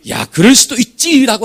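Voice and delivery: loud, slow, emphatic phrase; emphatic reassurance. Retold or imitated. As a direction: punch it out like a revelation. Repeating God's phrase emphatically.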